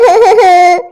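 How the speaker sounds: wailing sob; choked sob. Acted. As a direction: let out a crying wail. Girl cries.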